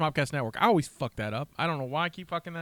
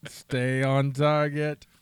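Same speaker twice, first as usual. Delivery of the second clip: very slow, drawn-out, louder command; deliberate, hammed-up movie line; slow film quote; 5 dB louder, much slower than usual. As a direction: stretch each word out insistently. Quoting film line on demand.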